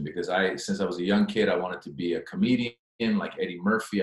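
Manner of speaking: ordinary talk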